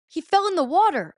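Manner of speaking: high, loud retold news-breaking; alarmed announcement. Friends tell her about her boyfriend, alarmed. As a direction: imitate a friend blurting out bad news.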